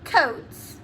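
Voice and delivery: ordinary delivery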